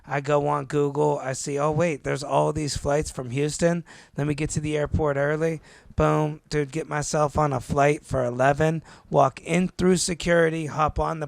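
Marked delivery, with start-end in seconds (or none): none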